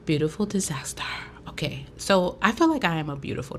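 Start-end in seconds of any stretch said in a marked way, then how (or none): 0.0-1.0 whisper. Real, not acted. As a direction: breathe it as a hushed intro. Whispered opening of the vlog.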